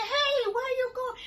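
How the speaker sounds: very high, loud shout; calling after her. Retold or imitated. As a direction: yell after someone walking away. Calling after departing friend.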